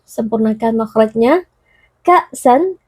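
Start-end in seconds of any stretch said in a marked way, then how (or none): 2.1-2.8 "Ka'san" higher, with a catch after "Ka"; checked hamzah. Shown as a demonstration. Teacher demonstrates syiddah, "Ka'san" with the sound held back. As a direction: stop the voice briefly at the hamzah in "Ka'san". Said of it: "membacanya dengan suara yang tertahan".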